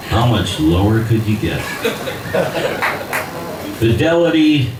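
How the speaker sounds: ordinary delivery, nothing marked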